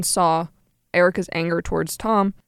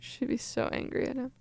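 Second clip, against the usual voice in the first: whispered aside, much softer; 5 semitones higher, 14 dB quieter than usual. Real, not acted. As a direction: breathe it under your co-host's line. Echoing co-host in aside.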